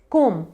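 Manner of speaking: कोम ending on m, silent b; correct pronunciation. Shown as a demonstration. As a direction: close कोम on the lips with no b. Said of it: "बी को हम silent रखेंगे". (कोम में ब चुप रखें)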